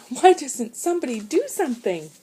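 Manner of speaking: high, loud frantic question; desperate plea. A narrator's reading. Stranded boy pleads with his brother, frantic. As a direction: demand help in a panic.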